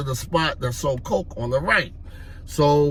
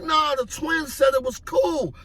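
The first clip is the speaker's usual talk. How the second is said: acted panicked excuse; 12 semitones higher than usual. Teller pleads his excuse at gunpoint.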